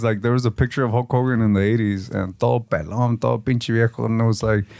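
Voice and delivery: ordinary talk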